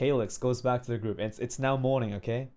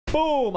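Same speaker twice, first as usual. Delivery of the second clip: loud, high shouted boom; hammer-blow boom; 13 semitones higher, 6 dB louder than usual. Acted. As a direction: punch out the impact, falling in pitch.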